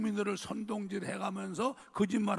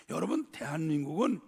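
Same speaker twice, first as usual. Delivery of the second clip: ordinary talk, nothing marked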